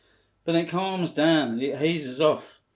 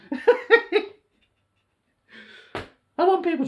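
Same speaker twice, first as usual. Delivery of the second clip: laughing; 13 semitones higher, slower than usual. Real, not acted. Laughs at own idea.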